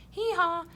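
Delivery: high, loud hee-haw; playful donkey call; donkey bray. Shown as a demonstration. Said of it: "that's a bray".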